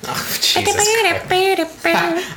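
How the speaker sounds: high, slow exasperated groan. Real, not acted. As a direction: drag it out in disbelief.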